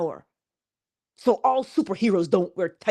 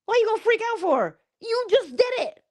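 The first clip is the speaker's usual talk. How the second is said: incredulous rebuke; 12 semitones higher, faster than usual. Real, not acted. Rebuking listeners for freaking out.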